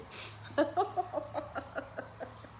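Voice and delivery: long, tailing-off giggle; helpless laugh. Real, not acted. Laughing at her own kitchen.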